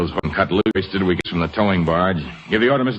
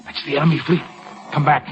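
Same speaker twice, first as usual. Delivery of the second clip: urgent orders; 6 semitones higher than usual. Acted. Enemy fleet sighted, urgent orders.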